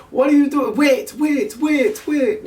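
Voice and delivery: put-on very high, alarmed old lady voice; high, frantic mock grandma; imitating grandma. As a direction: squeak out the grandmother's panicked protest. Grandma protesting being picked up.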